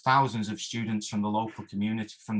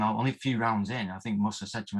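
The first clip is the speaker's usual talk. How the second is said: ordinary talk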